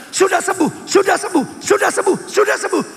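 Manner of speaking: high-pitched repeated cry of faith; insistent declaration. Retold or imitated. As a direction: keep proclaiming it all night, undeterred. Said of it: "sepanjang malam dia katakan". (seruan iman berulang dengan nada tinggi)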